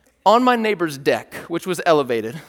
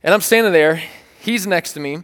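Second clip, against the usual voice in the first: ordinary talk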